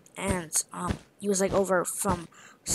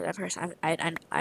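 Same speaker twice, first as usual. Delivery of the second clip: ordinary talk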